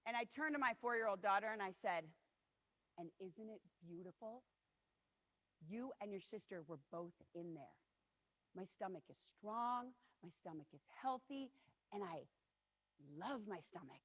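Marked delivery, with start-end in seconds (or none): 3.0-14.0 tender reply. Real, not acted. Mother gently answers her little daughter, soft tender reassurance.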